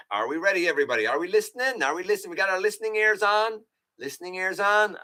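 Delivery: a hint of a preschool teacher calling for attention; mock teacher voice. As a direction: talk to the chat like a class of kids. Asks viewers like a schoolteacher.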